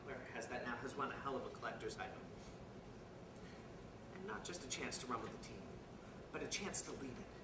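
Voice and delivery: low, soft, inward musing; wistful reflection. Acted. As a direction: drop low and soft, as if to yourself. Wistful reflection on loss and chance.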